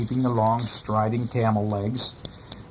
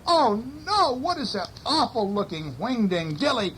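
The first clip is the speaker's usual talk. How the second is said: frightened townsfolk; 10 semitones higher than usual. A narrator's reading. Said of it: "They're all going".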